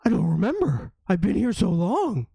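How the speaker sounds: dog voice. Acted. GM voices the hound.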